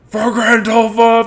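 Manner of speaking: high, loud, drawn-out rallying shout; shouted battle cry. Acted. Game master voicing cries from the other room, shouted battle cry. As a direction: belt it like a charge into battle. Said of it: "when you shout out for Grandolfa".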